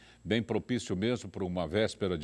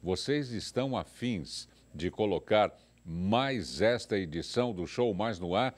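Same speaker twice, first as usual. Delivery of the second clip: ordinary delivery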